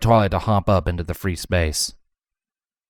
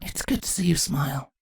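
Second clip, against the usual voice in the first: whisper; 8 semitones higher, 7 dB quieter than usual. Acted. Daughter at mother's sickbed.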